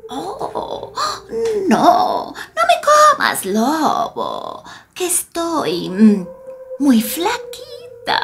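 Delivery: put-on high, fast little old lady begging; pleading grandma. Frightened grandma begging the wolf not to eat her, high and fast.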